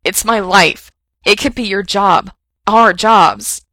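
loud, breaking outburst; blurted. A narrator's reading. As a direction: blurt it out, overwhelmed. Frank's outburst continues.

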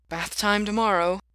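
mildly resigned announcement; a touch of resignation. A narrator's reading. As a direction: accept the chore with resignation.

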